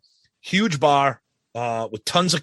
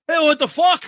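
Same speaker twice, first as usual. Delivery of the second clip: high-pitched caricature voice; exaggerated, high imitation of a drunk; cartoonish Aussie drunk; 12 semitones higher than usual. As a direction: talk like a cartoon Australian drunk. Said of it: "he'd always talk like this".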